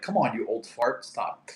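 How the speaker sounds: ordinary talk, nothing marked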